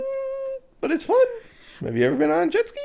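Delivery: very high squeaky put-on voice; naive enthusiast. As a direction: chirp it in a silly falsetto. Plays naive jet ski fan.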